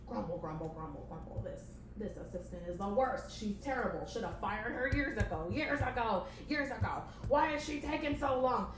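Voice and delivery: grumpy puppet boss rant; grumbling boss. Acted. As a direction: grumble sourly, rising into impatience. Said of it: "playing the boss character".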